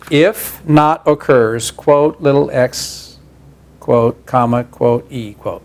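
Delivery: slow dictation, each symbol spelled out; dictating. Shown as a demonstration. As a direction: read the notation out slowly, piece by piece. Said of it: "single quote X, comma".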